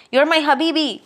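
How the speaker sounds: high and loud, beaming; loud, high, joyful farewell; excited sign-off. Real, not acted. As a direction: call it out fondly and brightly.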